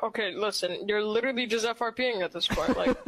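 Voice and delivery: ordinary delivery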